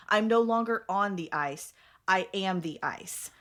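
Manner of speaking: steady, intense book reading; story narration read to friends; dramatic reading. Acted. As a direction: read the climax like an audiobook. Reads scene aloud.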